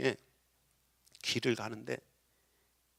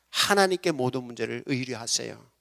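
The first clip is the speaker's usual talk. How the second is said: ordinary talk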